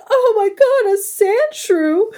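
high, drawn-out excited gasp; Meowth impression. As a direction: gush it like a cartoon cat. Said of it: "Meowth is like".

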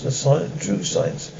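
whispered reverence; a near-whispered awe for true science; hushed. Acted. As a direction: breathe it out like a secret.

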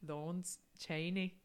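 put-on slow, failed Scottish accent; tentative go at a drawn-out Scottish accent; Lawrence Chaney. Co-host copying the impression and failing, slow attempted Scottish accent. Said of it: "No, I can't do it".

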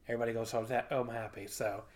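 trailing off. Real, not acted. Wrap-up of the show recap.

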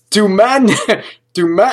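words broken up by real laughter; laughing. Real, not acted. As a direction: laugh through the question. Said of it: "I'm laughing because".

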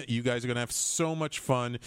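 ordinary talk, nothing marked

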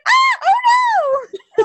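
high, loud, slow mock wail; hammed-up panicked wail; mock panicked cry. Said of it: "He's like".

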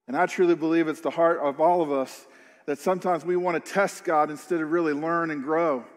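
ordinary delivery

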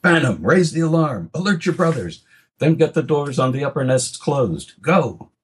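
high urgent call across the yard; urgent father raising the alarm. Acted. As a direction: shout orders to the eldest son. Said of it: "Ardren called to his eldest".

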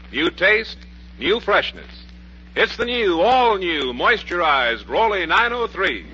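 usual speaking voice

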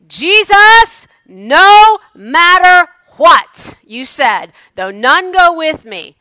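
high, loud, drawn-out cry of faith; defiant shout. Pretend. Preacher shouting to congregation his faith declaration. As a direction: shout it out to Jesus, full force.

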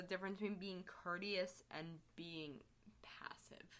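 soft breathy whisper. Real, not acted. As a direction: drop to a whisper for the last words. Dropping to a whisper.

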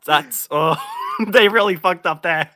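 loud, laughing outburst; laughing shout. Real, not acted. Shocked laughter at Netflix.

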